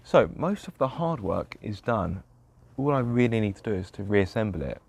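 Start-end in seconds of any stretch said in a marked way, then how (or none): none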